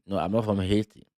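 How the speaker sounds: ordinary talk, nothing marked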